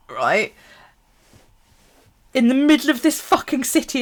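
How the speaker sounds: normal talking voice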